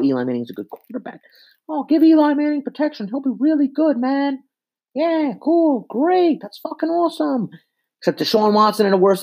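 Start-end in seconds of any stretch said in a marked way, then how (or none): 1.7-7.5 high, mocking dopey fan voice; mock Giants fan. Ranting fan mocks other Giants fans in a high silly voice. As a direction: sneer at the fan by parroting him in a silly high voice.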